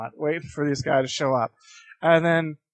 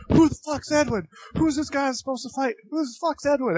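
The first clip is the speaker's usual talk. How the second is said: high-pitched mock confrontation; imitated aggressive challenger; 11 semitones higher than usual. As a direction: act out a rival demanding his opponent. Rival arrives demanding a fight.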